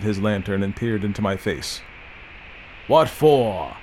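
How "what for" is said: a lightly voiced old man, sulky; sulky growl. A narrator's reading. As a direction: grumble it like a grumpy old man. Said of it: "growled he, sulkily".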